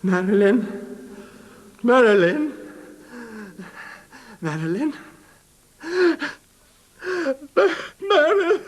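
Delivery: frantic calling. Acted. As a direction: call for her in rising panic. Wakes calling for absent wife.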